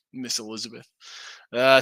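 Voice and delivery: ordinary talk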